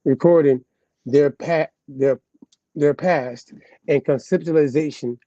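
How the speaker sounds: ordinary talk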